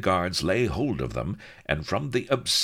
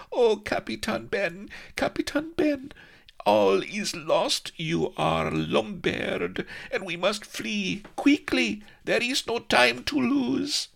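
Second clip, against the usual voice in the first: put-on high, gasping young woman in a panic; breathless high girlish voice; 3 dB louder, slower than usual. Melliflua gasping out the news to Captain Ben, breathless and high. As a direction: pant it out, high and fast, like a frightened girl.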